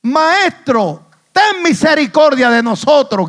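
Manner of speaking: high, loud cry for mercy; gritando a todo fuerte. Acted. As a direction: shout it as a desperate plea from far off. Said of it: "gritando a todo fuerte". (grito agudo de súplica)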